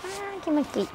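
very high little cat voice; voicing the cat. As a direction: speak for the contented cat in a high voice. 冷風を受ける猫の気持ちを代弁. (猫になりきった高い声)

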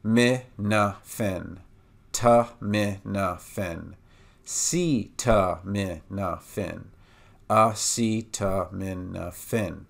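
very slow, syllable by syllable: mi na fen, ta mi na fen, si ta mi na fen; slow back building. Shown as a demonstration. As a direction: build acetaminophen from the end, each syllable clear and slow. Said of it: "We're going to start with fen".

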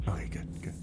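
unvoiced whisper to the co-host; quick hushed aside, off mic. Real, not acted. Whispered aside about editing.

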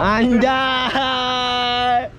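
high, drawn-out whoop; real high-pitched shout of amusement; exclaiming shout. Real, not acted. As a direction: burst out with a long teasing cry. Exclaims at friend, bursts out.

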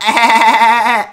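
loud cartoon cackle; SpongeBob laugh. Kid showing he is now SpongeBob, loud cartoon laugh. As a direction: rattle out a loud, silly put-on laugh.

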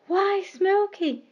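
light up with surprise at the kind cat; stretched, high surprised exclamation; delighted surprise. Acted. Mistress finds cat minding puppies.

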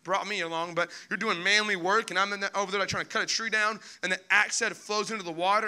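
ordinary talk, nothing marked

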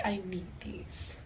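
breathy whispered want; whispering. Retold or imitated. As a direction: whisper it like a secret craving. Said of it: "So I was like".

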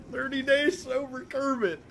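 a strained Kermit the Frog impression held through gameplay. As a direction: push the voice up and squeeze it like a felt frog. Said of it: "do the Kermit voice for two minutes".

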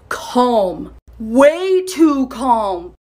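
very slow, drawn-out, ominous; eerily calm. A narrator's reading. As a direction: let each word hang, unsettlingly slow. Girl turns eerily calm.

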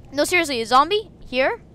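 ordinary talk

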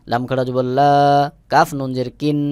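ordinary talk, nothing marked

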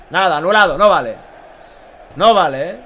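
ordinary talk, nothing marked

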